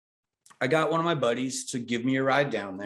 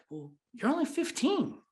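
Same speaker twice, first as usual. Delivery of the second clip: much higher put-on voice of the boss; imitating the boss; 14 semitones higher than usual. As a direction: play the boss gently turning him down, pitched up. Voicing Joe turning him down.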